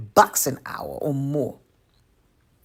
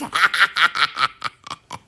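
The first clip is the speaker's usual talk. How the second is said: knowing laugh; 3 dB louder than usual. Real, not acted. Laugh after watch your back.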